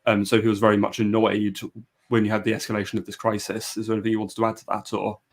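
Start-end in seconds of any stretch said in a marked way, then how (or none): none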